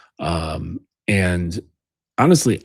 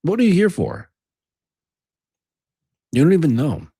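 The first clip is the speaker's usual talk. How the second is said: pitched-up, disbelieving challenge; exasperated berating; 8 semitones higher, 4 dB louder than usual. Acted. Speaker confronts imagined aimless men, exasperated. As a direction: press them for an answer they lack.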